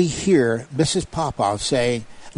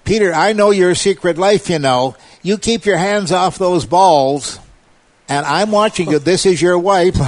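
mocking wife; 6 semitones higher, 5 dB louder than usual. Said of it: "she's saying to Peter".

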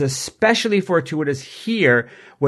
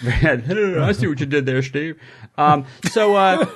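puppet voice. Acted. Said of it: "Bob's puppet voice".

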